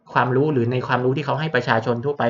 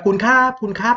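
high, raised call to rouse someone; loud calling out; 10 semitones higher, 3 dB louder than usual. Shown as a demonstration. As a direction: call out loudly to wake an unresponsive patient. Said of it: "ตะโกนเรียกดังๆเลยครับ".

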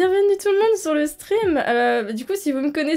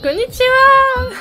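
excited greeting; 9 semitones higher, 8 dB louder, slower than usual. Real, not acted. Streamer greets raiders, performed greeting.

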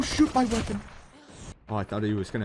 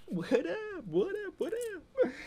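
squeaky mock greeting; 8 dB quieter, slower than usual. Gamer greets enemy mockingly.

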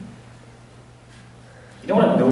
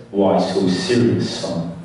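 menacing father; 8 semitones lower than usual. Acted. Father turns to child with the knife, low menace. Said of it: "Turns to me and says".

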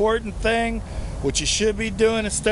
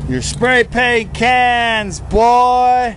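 loud, higher, slow gloating; gloating cop; 5 semitones higher, 5 dB louder, much slower than usual. Acted. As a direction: draw it out like a cop who's caught them. Mock cop gloating at taggers.

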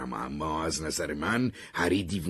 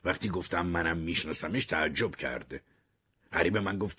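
plain reading voice

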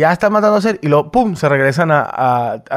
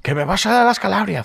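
mock Spanish accent; 5 semitones higher, 3 dB quieter than usual. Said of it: "español".

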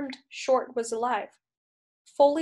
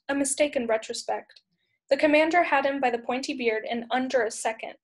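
plain reading voice

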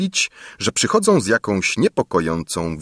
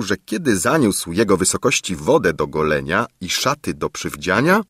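ordinary delivery, nothing marked

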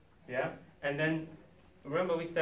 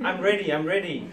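louder, hammed-up insistence with a laugh; insistent man; 3 semitones higher, 7 dB louder than usual. Man still in pajamas claims he is ready, mock insistent. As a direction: protest it twice, comically louder. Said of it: "this is what a man says".

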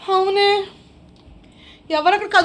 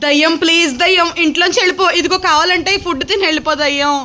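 raised, urgent play-acted begging; loud pleading; 7 dB louder than usual. Begging the ghost to eat and leave, loudly pleading.

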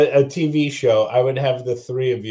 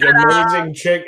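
ordinary talk, nothing marked

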